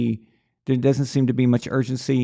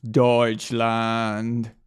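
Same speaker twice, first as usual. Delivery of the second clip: mock German; 2 semitones lower than usual. Playful word after German accent story.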